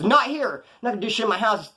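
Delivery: high-pitched homeboy waving off trouble; mock urgent objection; alarmed refusal. Mimics homeboy refusing a fight.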